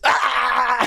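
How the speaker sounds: high yelped mock scream of fright; a hammed-up frightened "Ah!"; mock terrified scream. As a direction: shriek like a scared tough guy. Wrestler reacts to cellmate.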